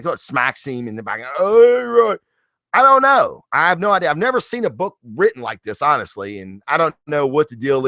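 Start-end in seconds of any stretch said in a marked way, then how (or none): 1.4-3.4 high, loud mock startle; mock startled. Writer smacked in the back, loud high startle. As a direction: jolt as if just smacked.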